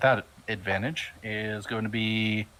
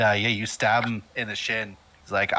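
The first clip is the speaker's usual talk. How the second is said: usual speaking voice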